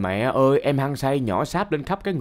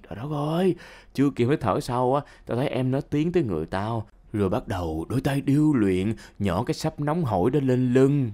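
ordinary delivery

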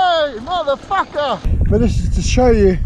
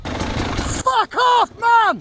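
yelled curse; 14 semitones higher, much slower than usual. Real, not acted. Struggling, yelling curse.

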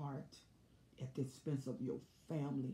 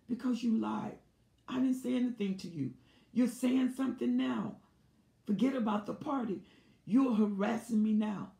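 loud, higher, fast, heated retort; heated argument; 8 semitones higher, 11 dB louder than usual. Retold or imitated. Wife fighting with husband in the car, loud and heated. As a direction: keep raising the stakes, louder each line.

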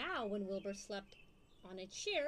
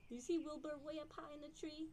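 plain reading voice

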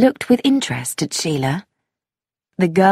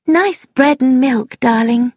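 higher, sweet-talking mother; coaxing mother; 6 semitones higher than usual. A narrator's reading. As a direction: coax a small child to eat. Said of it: "said Missus Sproat to her daughter".

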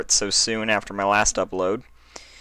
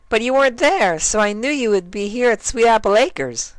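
very high cheerful pony voice; bright and goofy, way up high; very high, louder, happy Derpy; 6 dB louder than usual. Acted. Derpy talks to Dash.

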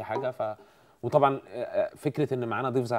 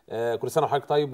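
usual speaking voice

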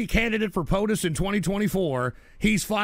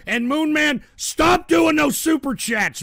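louder, higher, slowed scolding; fed-up shout; 8 semitones higher, 6 dB louder than usual. Real, not acted. Streamer scolding superchatter Moon Man, fed-up shout. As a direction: tell the troll off loudly.

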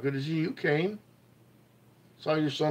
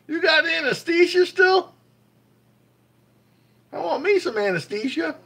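excited; 6 dB louder than usual. Real, not acted. Teasing viewer after surgery.

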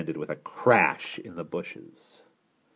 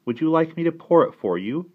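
put-on higher, louder child's voice; helpful child; 10 semitones higher, 9 dB louder than usual. A narrator's reading. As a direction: offer help eagerly. Child offers to help aunt.

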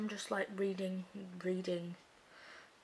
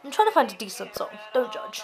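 loud defensive; 2 semitones higher, 12 dB louder, faster than usual. Real, not acted. Defensive outburst.

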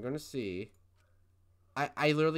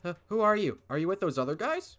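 put-on higher, louder girl asking in alarm; 6 semitones higher, 6 dB louder than usual. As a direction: play the frightened girl. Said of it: "I'll go with female voice".

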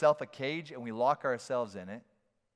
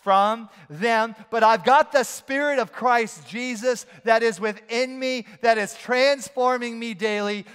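louder, higher, driving preaching; preaching at full force; 6 semitones higher, 8 dB louder than usual. Acted. Preacher closing the sermon over music, full force. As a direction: build the sermon toward its close with fire.

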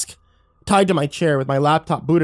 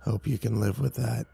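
low, quiet, raspy sneer; mock him with a rasp; 3 semitones lower, 8 dB quieter than usual. A narrator's reading. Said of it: "he mocked in a raspy voice".

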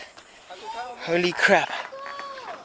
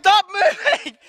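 laughing scared plea; 12 semitones higher, 3 dB louder, slower than usual. Real, not acted. Scared on swaying bridge.

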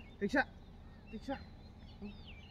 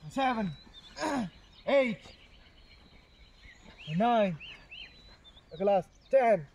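straining with effort; 2 semitones higher than usual. Real, not acted. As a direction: push out each number. Final hard reps.

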